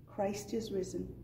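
normal talking voice

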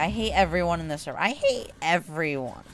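ordinary talk, nothing marked

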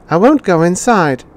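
put-on high, loud child's refusal; a spoilt boy digging in his heels; mayor's son shouting back at the robot; defiant boy.

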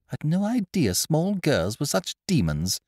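put-on breezy young master; young gentleman. A narrator's reading. Wooster voice.

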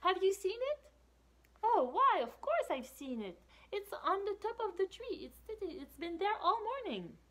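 high bright storybook character; squeaky cheerful helper. Acted.

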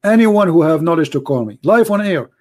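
ordinary delivery

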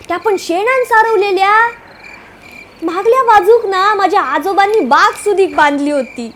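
ordinary delivery

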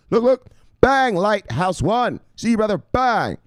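hype shoutout. Acted. Hyped shoutout, drawn-out bang.

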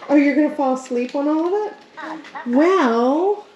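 ordinary talk, nothing marked

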